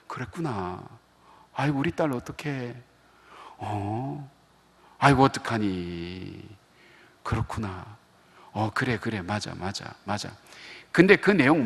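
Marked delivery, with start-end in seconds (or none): 0.1-10.3 softly comforting, a touch hushed; soothing wife on the phone. Retold or imitated. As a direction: gently console the daughter over the phone. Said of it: "집 사람 통화하는 거 ... 들어보면". (딸을 달래는 아내, 조용하고 부드럽게)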